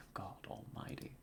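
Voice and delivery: breathless whispered God almighty; whispered oath. Acted. Detective hears the patient escaped, whispering in shock. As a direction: whisper it in dismay.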